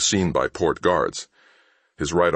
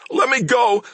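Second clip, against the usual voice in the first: high, louder begging; begged; 5 dB louder than usual. A narrator's reading. Thief begging Mal to let him go, high pleading. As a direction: beg for release. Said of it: "the man begged".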